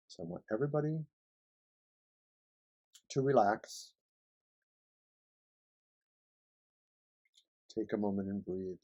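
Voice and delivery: soft, calm meditation voice; guided meditation. Acted. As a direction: ease them into relaxing. Leads a relaxation meditation.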